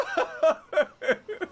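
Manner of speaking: high, loud laugh of despair; squealing laugh. Real, not acted. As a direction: laugh in helpless disbelief. Laughing at own blunder.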